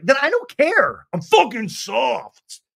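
high, loud, slow hammed-up snap; mock Lala defiance. Host imitating Lala dismissing Katie, slow, high and loud. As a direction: drag it out defiantly. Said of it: "And Lala's like".

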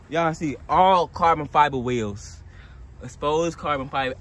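ordinary talk, nothing marked

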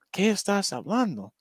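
tossing off a quick Spain-Spanish impression; mock Spain Spanish. Mimicking how people in Spain talk to a friend, light Castilian accent. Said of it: "ellos hablan un poco diferente".

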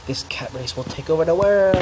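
cat overlord, voice rising and swelling; mock villain proclamation. Playing world-conquering cat villain.

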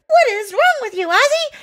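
high, fast, outraged classmate; Tilly. Retold or imitated. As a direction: blurt it out in indignant disbelief. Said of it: "how Tilly was like".

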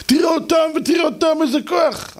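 high excited shout about their strength. Retold or imitated. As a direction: shout it out in awe.

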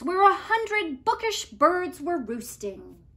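plain reading voice

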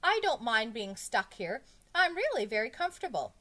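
high, loud insistence; frantic protest. A narrator's reading. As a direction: hurriedly insist you're fine. Pupil frantically protests after screaming.

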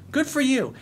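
high-pitched mock congratulation; sarcastic approval. Real, not acted. Coach retorting to a critic's letter, sarcastic. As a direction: toss it back, high and sarcastic.

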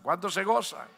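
normal talking voice

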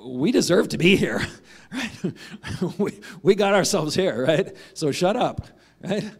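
loud, laughing rebuke of a fellow criminal; half-laughing, telling him to shut up; rebuking criminal. Acted. Criminal on the cross rebuking the mocker, loud and laughing.

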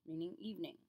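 ordinary talk, nothing marked

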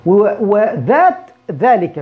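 ذات drawn out with a voiced th; slow careful that. Shown as a demonstration. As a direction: stretch ذات with a clear voiced th. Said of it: "وهنا بنطق ذا". (نطق ذات ببطء مع ذال واضحة)

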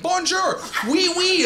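high, loud mock French accent; hammed-up French greeting. Shown as a demonstration. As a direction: put on a big, silly French accent. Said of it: "have, like, a French accent".

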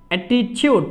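অ্যাটিটিউড said with the middle t as চ, "attichood"; t like Bengali চ. Shown as a demonstration. Teacher demonstrates the word for students, careful pron. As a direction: model অ্যাটিটিউড with a চ-like t. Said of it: "এই টি এর উচ্চারণ হবে চর মতো".

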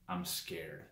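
hushed little confession; retold scared admission. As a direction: admit it quietly, as if retelling a weak moment. Retold fearful confession, drops low.

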